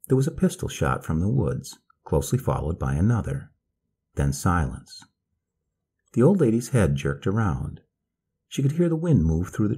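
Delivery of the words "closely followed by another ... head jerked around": ordinary delivery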